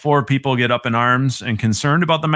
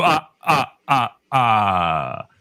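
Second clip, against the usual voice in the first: count's laugh; much slower than usual. Acted. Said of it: "You did a nice count voice".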